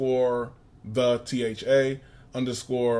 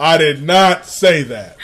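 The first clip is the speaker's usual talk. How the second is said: loud, slow protest, stress on not; loud denial; 3 semitones higher, 9 dB louder, slower than usual. Real, not acted. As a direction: insist loudly, slowly. Repeats denial.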